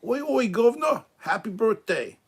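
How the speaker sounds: a clumsy mock English accent; bad Cockney accent. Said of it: "I can't do an accent to save my life".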